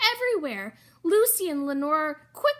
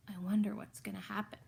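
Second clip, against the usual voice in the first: fast unvoiced whisper; quick hushed wondering aside; suspense whisper; 6 semitones lower, 7 dB quieter, much faster than usual.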